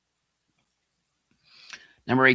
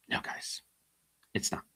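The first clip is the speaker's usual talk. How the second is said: whispered, much softer; hushed aside; 11 dB quieter than usual. Acted. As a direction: lean in and whisper it to the viewers.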